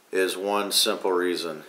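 ordinary delivery

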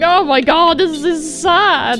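ordinary talk, nothing marked

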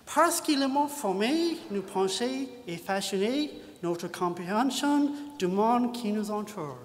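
halting French read with a thick English-speaking accent; a lightly English-accented French line; terrible French accent. Shown as a demonstration. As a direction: read it out in clumsy schoolbook French. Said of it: "my terrible accent and grammar".

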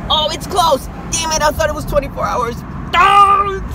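high, loud shocked complaint; surprised dismay. Real, not acted. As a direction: react to the shut door. Finds store closed.

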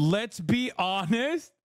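high, slow mock of "Let's be honest." with a rising end. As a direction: drag out "Let's be honest." sarcastically. Streamer mocks interviewer's line.

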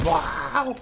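strained, breathy shouted call; excited shout. Real, not acted. Calling out the pot madness power-up, excited shout. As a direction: bellow the power-up name.

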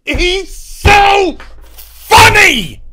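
high, slow, loud wailing cry; mock wailing shout. Mocking the trailer, a high slow wailing shout. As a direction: ham up a pained howl.

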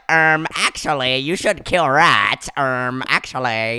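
imitating the nerd emoji to the prisoner; nerd emoji impression. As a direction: correct them like a smug nerd. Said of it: "nerd emoji".

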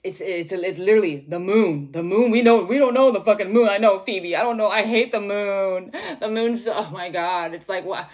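loud, worked-up frustration at the moon; mock-furious outburst; exasperated rant. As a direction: vent it loudly, hammed up.

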